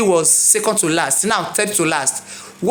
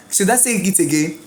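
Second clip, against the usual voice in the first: ordinary talk, nothing marked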